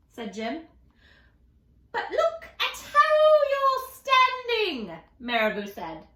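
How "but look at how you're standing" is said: very high, slow and loud; high bird voice. Acted. Marabou showing Jim his posture, very high. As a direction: point it out brightly.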